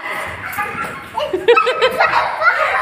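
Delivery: high-pitched gleeful laugh; long, high giggling fit. Real, not acted. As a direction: burst out laughing at your own prank. Laughs as children run off.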